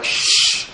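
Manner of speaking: long unvoiced shushing sound; crowd's shush. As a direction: hush someone like a disapproving onlooker. Crowd hushing the blind man.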